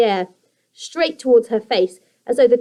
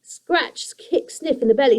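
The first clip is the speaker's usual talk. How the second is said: usual speaking voice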